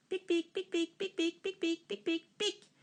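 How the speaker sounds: pics aigus et vifs. A narrator's reading. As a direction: imite les écureuils, très haut. Écureuils imités. (pics d'écureuils aigus)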